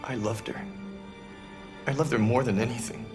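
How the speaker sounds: grieving. Acted. Grieving over lost loved one.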